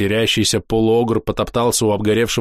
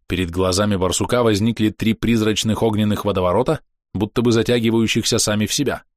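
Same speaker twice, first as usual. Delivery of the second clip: plain reading voice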